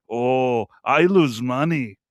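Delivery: slow, drawn-out mock Thai seller; imitating Thai vendor. Tourist mimicking the Thai seller's complaint, slow accented imitation. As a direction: stretch the words like a haggling vendor. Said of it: "they go".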